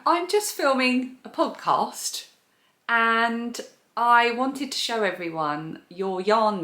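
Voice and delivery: high, slow presenter delivery; podcasting voice. Real, not acted. Vlogger recording a voice note for her friend, podcasting voice. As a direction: spell it out slowly for the listeners. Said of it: "with her podcasting voice".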